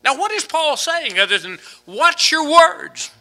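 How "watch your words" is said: slow, high emphasis. Acted. Slow emphatic sermon quote.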